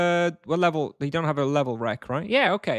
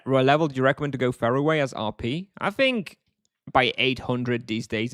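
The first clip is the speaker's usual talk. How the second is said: ordinary talk, nothing marked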